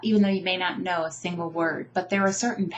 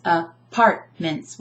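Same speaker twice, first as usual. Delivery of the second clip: "apartments" slowly in chunks, a-PART-ments; "apartments" split by syllable, middle one strongest; stress on second syllable; 2 semitones lower, 4 dB louder, slower than usual. Shown as a demonstration. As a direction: tap out "apartments", leaning on PART. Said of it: "I'm emphasizing the second syllable".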